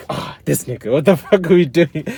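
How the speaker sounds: loud, high, fed-up outburst; hammed-up exasperated yell at a failing kid; mock exasperated God. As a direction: blow up like an annoyed boss. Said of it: "he has been like".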